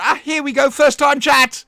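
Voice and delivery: loud, high burst of excitement; excited announcement. Real, not acted. Streamer spotting a first-time chatter, excited shout. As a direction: call it out high and loud, thrilled.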